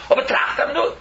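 ordinary talk, nothing marked

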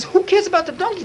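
high, indignant outburst; angry judge. Retold or imitated. Judge angrily dismissing the donkey case, high and indignant. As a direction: brush the donkey aside in outrage. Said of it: "So he got so angry".

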